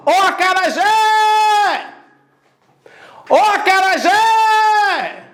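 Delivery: high, long-held call. Shown as a demonstration. Teacher demonstrating the stressed syllable, calling out. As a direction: holler it high and hold the final "jé". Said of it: "Você vai chamar".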